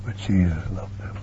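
soft intimate whisper. Acted. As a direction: lean in and whisper it. Tender sermon point.

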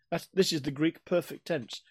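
normal talking voice

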